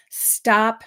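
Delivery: ordinary talk, nothing marked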